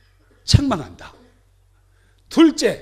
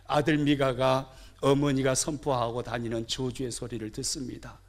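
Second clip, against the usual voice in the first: ordinary delivery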